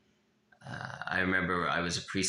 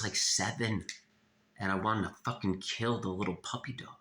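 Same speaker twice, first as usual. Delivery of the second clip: ordinary talk, nothing marked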